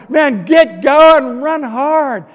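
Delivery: high, stretched urging yell; re-enacted shout from the stands; sports-fan shout. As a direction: urge the runner on, loudly at first. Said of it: "I just wanted to stand up and shout".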